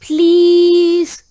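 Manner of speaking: loud begging please; pleading. Acted. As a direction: beg him loudly. Kids begging the pigeon.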